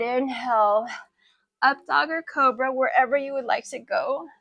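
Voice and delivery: ordinary talk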